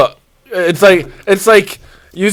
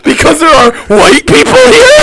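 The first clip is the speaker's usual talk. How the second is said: laughing, high mock offense; high laughing imitation of the coworker; mock outrage; 9 semitones higher, 5 dB louder than usual. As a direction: squeal it through laughter.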